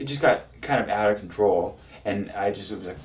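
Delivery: ordinary talk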